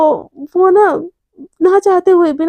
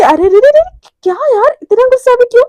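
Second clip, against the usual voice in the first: high, louder playful protest; teasing protest; 9 semitones higher, 4 dB louder than usual. Acted. Romi teasing her angry best friend, mock-placating. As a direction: coax them with exaggerated surprise.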